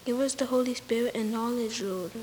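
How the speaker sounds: ordinary delivery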